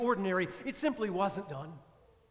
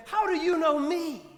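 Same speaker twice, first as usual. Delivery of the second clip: higher and louder, startled; frightened and excited; 5 semitones higher, 6 dB louder than usual. A narrator's reading. Astonished woman asking the stranger at the well. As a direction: ask in amazed alarm. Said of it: "frightened and excited".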